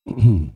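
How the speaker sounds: a deliberate throat clear before speaking; dad's throat clear. Retold or imitated. Son imitates his father's throat clear before the call. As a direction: clear your throat like an old man about to talk. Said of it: "He would clear his throat".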